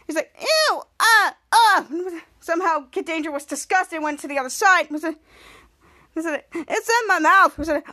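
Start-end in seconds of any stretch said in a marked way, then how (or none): 0.4-1.9 high, loud disgusted cries; disgusted shriek. Retold or imitated. Sneezed in the face. 6.5-7.6 slow, higher disgusted whine. Retold or imitated. Captain Man disgusted.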